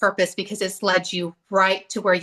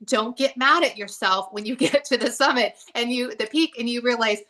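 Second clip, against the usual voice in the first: ordinary delivery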